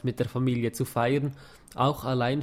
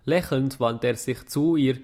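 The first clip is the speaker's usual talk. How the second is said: usual speaking voice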